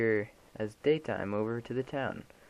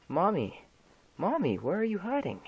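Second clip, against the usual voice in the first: put-on higher, sing-song creepy child voice; eerie child calling; 10 semitones higher than usual. Dead son hunting his hiding mother, eerie childlike call. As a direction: call out like a ghost child seeking her.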